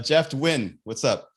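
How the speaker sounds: ordinary delivery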